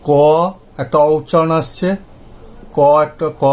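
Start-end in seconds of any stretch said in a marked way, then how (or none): none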